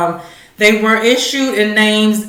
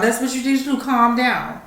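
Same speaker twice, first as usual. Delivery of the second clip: normal talking voice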